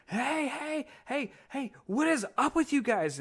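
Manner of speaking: high, strained mock yell; imitated screaming chief. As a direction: holler like an irate chief charging over. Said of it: "starts screaming at us".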